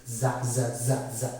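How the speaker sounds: low, repeated ZA, a voiced z; za as a buzzing consonant sound; isolated sound demo. Shown as a demonstration. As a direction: sound out za in short even repeats. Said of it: "it becomes za".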